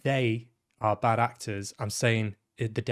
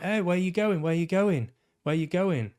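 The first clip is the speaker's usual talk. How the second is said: higher, insistent mock call after someone leaving; 7 semitones higher, 3 dB louder than usual. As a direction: pester him with a repeated, raised question. Acting out calling after someone leaving.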